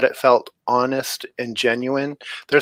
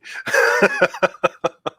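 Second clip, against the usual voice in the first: light laugh; 7 semitones higher than usual. Real, not acted. Laughing at family joke.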